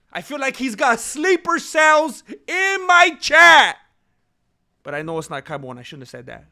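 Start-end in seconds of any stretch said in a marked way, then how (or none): 0.1-3.8 louder, slower, higher shout; half-joking paranoid shout; mock paranoid outburst. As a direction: yell it like a conspiracy theorist. Paranoid rant about spies.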